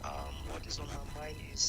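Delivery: faint, breathy near-whisper "Um, what is on my mind is"; barely audible. Real, not acted. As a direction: voice too low to hear on the line. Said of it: "We can't hear you, Your voice is very low".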